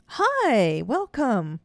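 high, bright-pitched congenial greeting. Retold or imitated. Allison greets the medium at the door, suddenly congenial. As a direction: imitate a gushing, sing-out welcome, pitched well up. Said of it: "the next instant is congenial Allison".

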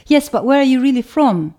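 a mock of someone insisting on an answer; insistent questioner. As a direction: press the question like an impatient stranger. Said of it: "they would insist".

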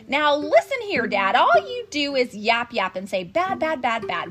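loud angry yell. Acted. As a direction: yell it out angrily. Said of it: "I yelled at the Lorax".

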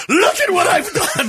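grandiose proclamation. Acted. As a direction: proclaim your handiwork to the heavens. Second, grandiose take of the boast.

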